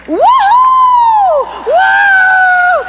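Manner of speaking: high, loud, drawn-out whoops; excited whooping. Retold or imitated. God delighting as the box pops open, excited whooping. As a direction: whoop with unrestrained glee. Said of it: "He gets so excited every single time".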